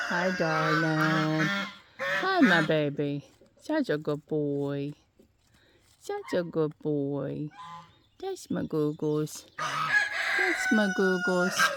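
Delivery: drawn-out tender coo. Real, not acted. Owner cooing to her pet goose, slow and tender.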